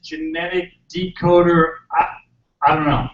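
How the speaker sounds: ordinary talk, nothing marked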